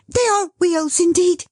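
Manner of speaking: high, breathless excitement; panting. Acted. Dot announcing the arriving wheels, breathless. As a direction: gasp it out. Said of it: "she panted".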